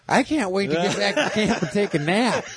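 high sing-song doll voice with laughter; pull string toy. Retold or imitated. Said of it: "she's like".

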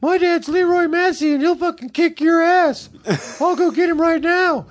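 put-on very high, squeaky threatening teen; Randy Massey impression. Storyteller as teen friend bragging about his dad, very high squeak. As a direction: threaten in a squeaky grown-up Mickey Mouse voice. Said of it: "He kinda talked like a".

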